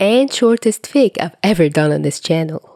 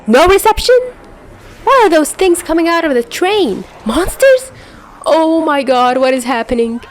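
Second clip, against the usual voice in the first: much higher, louder, frantic questions; panicked; 10 semitones higher, 4 dB louder than usual. Acted. Narrator panicking as monsters pour out of the train.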